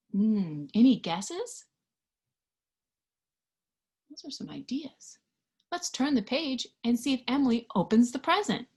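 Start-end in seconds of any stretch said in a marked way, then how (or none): 4.1-5.2 soft whispered aside. Real, not acted. Praising the children's guesses in a whisper. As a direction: whisper it kindly to the listeners.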